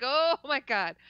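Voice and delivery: retold shock. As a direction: replay the stunned recognition. Repeats the retold shocked reaction.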